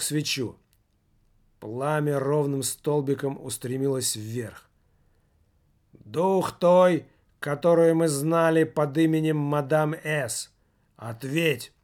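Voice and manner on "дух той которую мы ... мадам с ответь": raised, commanding call to the spirit; solemn summoning. Acted. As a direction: summon her with ritual authority. Medium summoning by candlelight.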